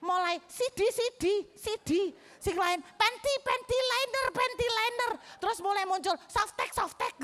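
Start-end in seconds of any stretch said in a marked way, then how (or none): 0.5-2.0 high, excited pupil chant; rowdy chiming in. Retold or imitated. Pupils add more rude vocabulary, rowdy chiming in. 2.8-5.1 high-pitched repeated mock chant; rowdy chiming in. Pupils shout more answers in class, rowdy chiming in. 6.2-7.2 rowdy chiming in. Retold or imitated. Rowdy pupils acted out.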